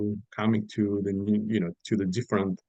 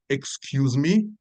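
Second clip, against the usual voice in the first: slow, drawn-out offended excuse me; mock angry excuse me; 4 semitones higher, much slower than usual. To a customer, slowly and mock offended. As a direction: stretch it out like you took offence. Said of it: "really angry".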